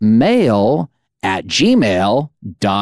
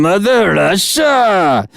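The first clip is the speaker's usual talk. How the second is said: a slow, hammed-up Russian accent on Mother Russia; mock Russian accent; 7 semitones higher, slower than usual. Host greeting Russian listeners in a jokey Russian accent. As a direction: play a cartoon Russian for a joke. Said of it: "I can't do those accents".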